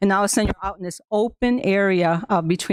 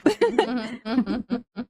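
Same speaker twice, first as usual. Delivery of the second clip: light laugh; 4 semitones higher, 4 dB quieter than usual. Real, not acted. Laughs after her greeting.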